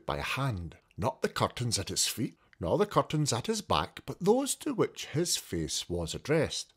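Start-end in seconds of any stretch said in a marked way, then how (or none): none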